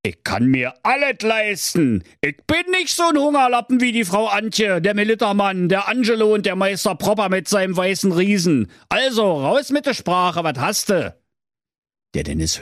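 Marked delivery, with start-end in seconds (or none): 0.0-11.2 furious. Acted. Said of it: "ganz fuchsteufelswild und rief".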